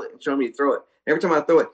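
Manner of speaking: ordinary talk, nothing marked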